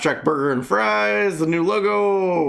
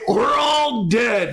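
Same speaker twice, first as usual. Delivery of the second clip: mock doom shout; 6 semitones higher, much slower than usual.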